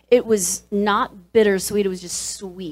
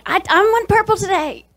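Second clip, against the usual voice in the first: put-on high, loud childish insistence; mock squabbling demand; 13 semitones higher, 6 dB louder than usual. Actress reenacting morning fights over tape colour, loud mock demand.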